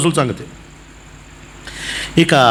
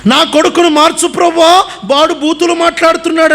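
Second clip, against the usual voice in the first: pleading prayer; 8 semitones higher than usual. Retold or imitated. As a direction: beg God like a worried parent. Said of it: "అంటాడు". (ప్రభువును వేడుకునే తల్లిదండ్రుల స్వరం)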